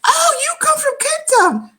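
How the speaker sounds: high, bright mock English reaction; imitating Londoners. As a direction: chirp it back like a surprised Londoner. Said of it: "people just said to me".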